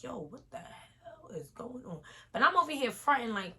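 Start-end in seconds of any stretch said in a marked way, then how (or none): 0.0-2.0 hushed unvoiced whisper, rattled; whispering to herself. Drunk in the spinning bathroom, whispering in panic. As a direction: breathe it out, freaked and dizzy.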